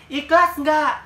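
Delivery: imitating the beggar woman's slow threatening challenge; menacing challenge. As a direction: loom over her, stretching every word. Beggar woman presses again.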